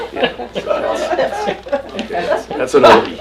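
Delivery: words breaking through laughter; laughing. Real, not acted. Teacher recovering from own joke, laughing through words. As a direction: still chuckling as you talk.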